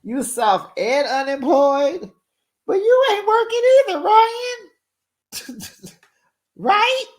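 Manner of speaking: hammed-up clapback at Ryan; mocking clapback. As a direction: sass the man right back. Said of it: "that had me dying laughing".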